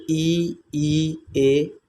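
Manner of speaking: ई and ए said long and isolated, tongue forward; vowel demo. Shown as a demonstration. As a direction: demonstrate ई and ए one by one. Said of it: "अगर आप इन स्वरों का उच्चारण करेंगे". (ई और ए को अलग-अलग लंबा खींचकर बोलना)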